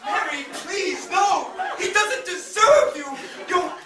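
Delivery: high, loud melodramatic pleading; desperate shout. Acted. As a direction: beg her at the top of your lungs. Narrator pleads with Mary.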